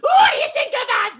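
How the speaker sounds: very high, loud squeaky villain; squeaky unicorn voice. Acted. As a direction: shout it like a squeaky bad unicorn. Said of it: "The unicorn said".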